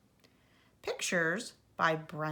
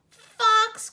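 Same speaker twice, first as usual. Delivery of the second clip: high, loud call across the yard; mother calling her child in; 12 dB louder than usual. A narrator's reading. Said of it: "called his mother".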